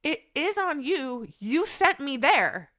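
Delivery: slow, deliberate, each word landed; firm pushback. Retold or imitated. Patient pushing back at her doctor, slow and firm. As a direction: lay the blame back on her, slowly.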